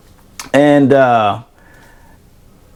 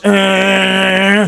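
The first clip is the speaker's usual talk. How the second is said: drawn-out higher buffer drone; imitated machine whine; 5 semitones higher than usual. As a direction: make the steady noise of a floor buffer. Imitates the floor buffer running.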